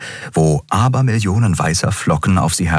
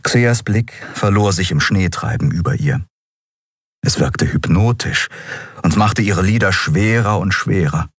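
ordinary delivery, nothing marked

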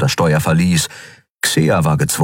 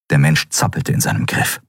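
usual speaking voice

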